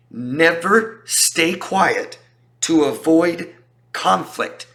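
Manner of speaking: each word drawn out, stress on Never; slow it down and hit Never; emphatic slow. Real, not acted. Coach to viewers, slow emphatic warning. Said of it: "emphatic advice, very slow".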